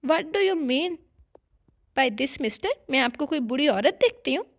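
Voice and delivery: put-on girl pulls a face at the stranger's remark, sulky retort; pouty retort. A narrator's reading. Young woman snapping back at a man, affronted and pouting. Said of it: "मुंह बनाकर बोली".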